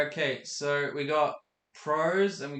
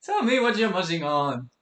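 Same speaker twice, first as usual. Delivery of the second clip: mock demanding; 5 semitones higher, 7 dB louder than usual. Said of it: "He is like".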